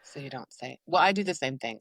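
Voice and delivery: usual speaking voice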